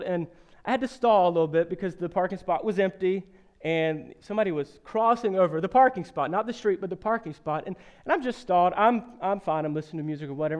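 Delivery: ordinary talk, nothing marked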